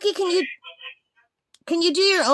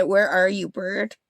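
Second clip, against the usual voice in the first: imitating the parrot; 9 semitones lower than usual. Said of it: "I'm pretty sure she just said".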